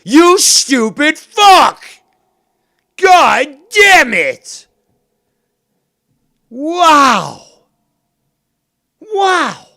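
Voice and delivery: screaming, high and loud, slow and drawn out; furious scream. Real, not acted. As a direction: lose it completely, bellow every word at him.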